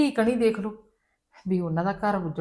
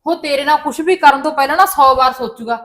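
loud, emphatic reassurance; forceful insistence; 6 semitones higher, 8 dB louder than usual. Acted. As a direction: drive it home loudly to calm her. Mother insists forcefully to daughter. (ਜ਼ੋਰ ਦੇ ਕੇ ਉੱਚੀ ਆਵਾਜ਼ ਵਿੱਚ)

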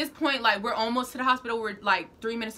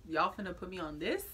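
quiet disbelief; 4 semitones lower, 10 dB quieter than usual. Retold or imitated. Staring at tiny stretcher.

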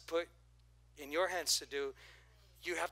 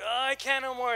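mock whine; 8 semitones higher, 8 dB louder than usual. Mock giving up.

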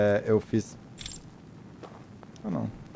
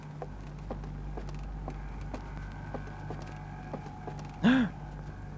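gasp; 12 semitones higher, 11 dB quieter than usual. Real, not acted. Jump scare in game.

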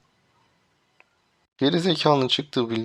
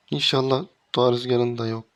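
normal talking voice

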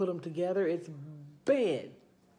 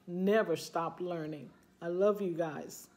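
ordinary talk, nothing marked

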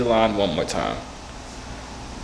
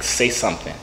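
ordinary talk

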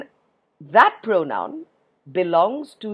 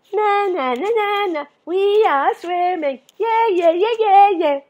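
put-on high, loud sing-song kids; carefree children; 12 semitones higher, 5 dB louder than usual. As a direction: chant like children happily swimming along. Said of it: "the children are all going".

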